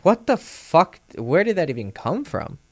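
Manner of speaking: high-pitched, incredulous outburst; shocked. Retold or imitated. Storyteller reliving being put down in front of a girl, shocked. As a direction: react as if blindsided, pitch jumping up. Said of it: "And I was just shocked".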